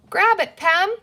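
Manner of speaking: high, loud urgent call; urgent cry. A narrator's reading. Girl calls to her sister to grab the arm, urgent cry. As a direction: shout the order as you dive in. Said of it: "cried Penny".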